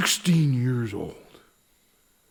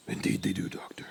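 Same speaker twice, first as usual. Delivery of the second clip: weary whisper; 5 semitones lower, 10 dB quieter, faster than usual. Acted. Sheriff weary reply.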